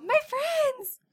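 put-on high squeaky little girl voice; little cartoon girl. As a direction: chirp it like a cartoon toddler. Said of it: "the little girl".

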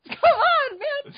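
high exasperated mock protest; retold exasperation. Mother too late to stop candy theft, exasperated.

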